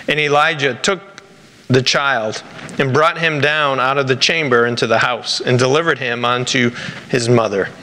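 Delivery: ordinary talk, nothing marked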